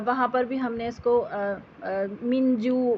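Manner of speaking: ordinary talk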